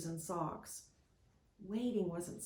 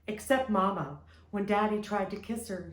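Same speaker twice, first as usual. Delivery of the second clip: plain reading voice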